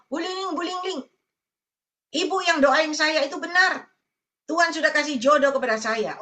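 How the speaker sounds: a light, high-pitched voicing of an excited woman calling out to Bu Lingling. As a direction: imitate a woman thrilled that her prayer was answered. Woman excitedly reporting answered prayer. (menirukan perempuan yang girang)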